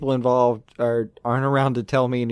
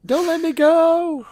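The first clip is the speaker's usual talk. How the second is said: high, drawn-out cry for help; mock pleading wail; 14 semitones higher, 4 dB louder, much slower than usual. Co-host playing the friend hanging off a ledge, mock wail. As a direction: wail it slowly, hammed-up panic. Mocking the dangling climber's cry.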